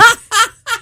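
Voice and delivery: high burst of laughter; bursting out laughing before the impression; loud laugh. Real, not acted.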